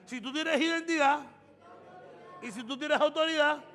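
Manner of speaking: loud, high, building preacher cry; preaching at full force. Acted. Pastor exhorting the church, loud and high. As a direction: drive the point home to the congregation.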